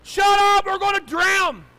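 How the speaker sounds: high, drawn-out scream; panicked yell. Retold or imitated. Terrified child to adults in boat, panicked yell. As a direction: scream it slowly for your life. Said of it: "And I yelled".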